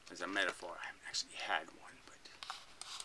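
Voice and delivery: quick unvoiced whisper; whispered aside. Real, not acted. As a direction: drop to a whisper for a sheepish aside. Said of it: "aside to the listener".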